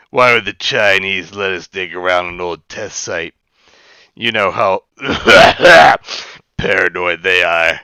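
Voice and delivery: character line breaking into a laugh. Acted. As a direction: laugh through the line, then finish it. Habgintra voiced, laughing mid-line.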